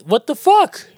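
high loud disbelief; shocked. Real, not acted. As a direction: blurt it out in shock. Shocked outburst.

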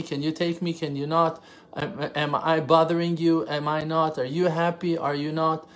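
ordinary talk

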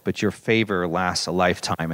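prayerful echo. Acted. Pastor echoing the psalm in prayer, low and hushed. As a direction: pray favor back gently to God.

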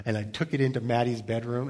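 normal talking voice

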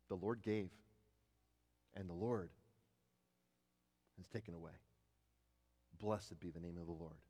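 hushed, low, broken by long pauses; solemn quotation. Acted. Preacher quoting Job in loss, solemn and hushed. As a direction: let each phrase sink, softly.

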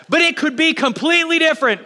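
hopeful cry with a laugh. Real, not acted. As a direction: burst out with laughing hope. Laughing burst of hope.

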